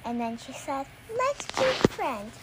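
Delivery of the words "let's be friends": creepy doll voice. Pretend. Doll speaks in told story.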